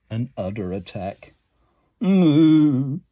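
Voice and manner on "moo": loud drawn-out moo; grumpy cow mooing. Acted. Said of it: "said the cow".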